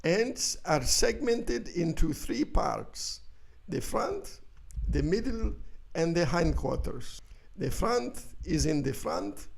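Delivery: Doctor Hans Heinz. Acted. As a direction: lecture on ants like a grave Viennese expert. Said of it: "Again, Doctor Hans Heinz".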